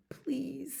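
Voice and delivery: small, soft, high plea; high little mouse voice; teeny tiny voice. A narrator's reading. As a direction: plead quietly as a tiny mouse. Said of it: "in a teeny tiny voice".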